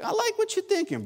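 high mock king voice. Preacher plays the king approving Haman, high and jokey. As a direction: squeak it out like a goofy king.

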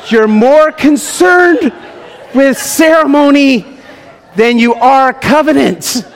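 slow, loud, climbing emphasis; building preaching shout. Real, not acted. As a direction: lean hard into the repeat, rising to a laughing shout. Preacher peaks on repeat.

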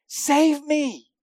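loud, high cry for help; desperate, drawn-out shout; yelling for rescue. Acted. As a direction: yell it like someone drowning calling the lifeguard. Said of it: "and yelling to the life".

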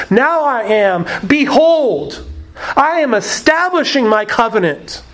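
BEHOLD lifted high and set apart; weighty, emphatic declaration with BEHOLD punched. Shown as a demonstration. Preacher shows congregation how the Hebrew reads, very emphatic. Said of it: "it's actually very emphatic".

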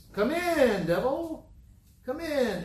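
high, singsong invitation; hammed-up high-pitched welcome; mock welcoming. Mimes welcoming the devil in.

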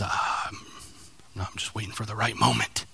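very soft, low hesitant excuse; acted reluctant groom, hushed; sheepish stalling. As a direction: mumble a feeble excuse under your breath.

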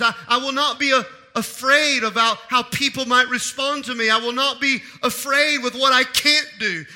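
high, loud, rising declaration; a preacher pouring out a fearless vow; impassioned preaching. Acted. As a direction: declare it with mounting fervour.

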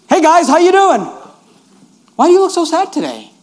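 cheerful Joseph. Retold or imitated. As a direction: breeze in upbeat and friendly. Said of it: "Joseph walked in like this".

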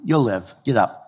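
low, soft, no-nonsense dad; offhand father. Retold or imitated. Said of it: "a father talking to his kid".

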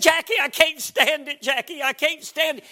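high, loud, tense cry through gritted teeth; imitating a man at the end of his nerves; gritted-teeth anguish. Pastor with shattered nerves cries to his wife, acted anguish. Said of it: "gritting his teeth".

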